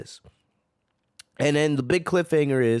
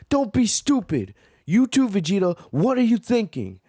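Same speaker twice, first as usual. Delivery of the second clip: high, a bit louder, scolding; mock scolding; 7 semitones higher, 3 dB louder than usual. Goku telling off Granola and Vegeta, high scolding. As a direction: scold them like a fed-up friend. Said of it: "Goku's like".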